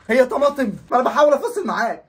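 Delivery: loud exasperated yell at dad; fed-up yelling son; frustrated shout. Acted.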